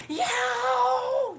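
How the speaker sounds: high, loud cartoon yell; cartoon scream. Streamer imitating the Tom and Jerry scream, high and loud. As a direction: holler it like a cartoon cat in pain. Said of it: "he sounded like the voice when Tom and Jerry".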